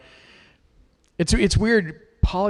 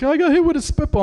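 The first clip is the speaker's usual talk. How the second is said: put-on high, louder kid complaint; whiny kid; 11 semitones higher, 4 dB louder than usual. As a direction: whine it like a hurt schoolboy. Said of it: "He's like".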